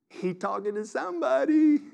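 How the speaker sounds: imitated churchgoer's aside, pitched high and rising; knowing churchgoer. Churchgoer in the pew about someone else, mock knowing. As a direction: lift it high like a mock pointed remark. Mimics churchgoer remarking on preacher.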